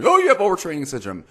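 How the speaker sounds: quick, high, loud mock quote; rushed parody of a careless professional; mocking lazy diagnosis. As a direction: toss off a glib diagnosis in mockery. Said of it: "the guy didn't actually test you".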